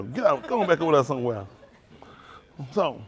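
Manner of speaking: usual speaking voice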